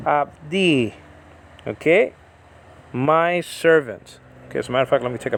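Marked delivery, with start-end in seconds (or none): 0.1-0.9 "abdi" with the weight on DI; stress on second syllable. Shown as a demonstration. Teacher sounding out the Hebrew word for servant, second-syllable stress. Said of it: "emphasis is on the second syllable".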